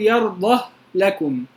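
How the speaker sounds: يرضه stopped on a still ه, then لكم; vowelless ha. Shown as a demonstration. Teacher warns against this reading, still ه ending يرضه. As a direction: cut the vowel after the ه in يرضه. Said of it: "ولا اقول".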